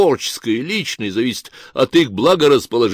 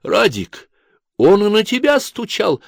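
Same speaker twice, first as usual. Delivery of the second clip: higher gasp of shock; 10 semitones higher than usual. Acted. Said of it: "ахнула Кларка". (ахнула от изумления)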